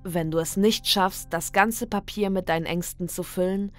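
ordinary delivery, nothing marked

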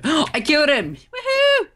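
gasp, then high whooping triumph; triumphant. Real, not acted. Gamer gets a surprise kill, high excited cheer. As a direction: celebrate the kill in a high squeal.

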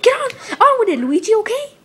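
high, impatient outburst; exasperated. Acted. Mario hurrying stammering Luigi along, exasperated. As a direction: cut in, voice jumping up.

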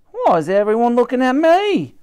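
squeaky alarmed charwoman; alarmed cleaning lady. Acted. Dottie alarmed.

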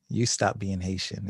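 usual speaking voice

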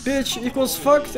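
ordinary delivery

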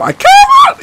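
very high, loud squeaky crack; piercing strained yell. Shown as a demonstration. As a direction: push the voice up until it squeals. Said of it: "raise my voice, like, a certain level".

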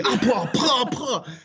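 high, puffed "puh" hits; comic noises of being butted repeatedly; imitated impact grunts. Storyteller reenacting teenage dolphins butting him, high impact noises.

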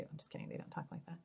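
quick breathy whisper, taking the joke back; whispered aside. Real, not acted. To viewers, after a Boston joke, a quick whisper. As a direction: drop to a fast whisper, just kidding.

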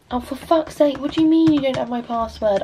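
ordinary delivery

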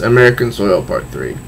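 ordinary delivery

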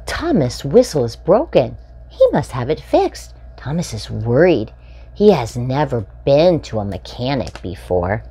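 bright, lively storybook read-aloud; animated children's story reading. A narrator's reading. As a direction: read it like a picture book for little ones. Reading picture book to children.

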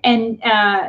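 normal talking voice

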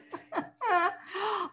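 high mocking giant laugh. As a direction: laugh down at the boy. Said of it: "all he could do was laugh".

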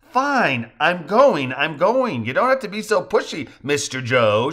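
light mock annoyance; playful protest. A narrator's reading. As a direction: grumble back teasingly. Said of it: "laughing as she climbs the stairs".

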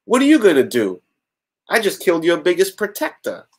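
lightly acted villain gloating; taunting villain. Villain gloating over killing her protector, hammed-up taunt.

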